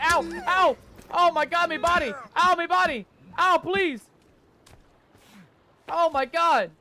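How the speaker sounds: loud, high yelps of alarm; pained yelling. Real, not acted. Player under attack in the game, yelling in pain. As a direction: cry out as if getting whacked.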